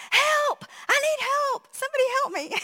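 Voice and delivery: imitating a panicked husband screaming for help; retold scream. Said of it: "he just screams".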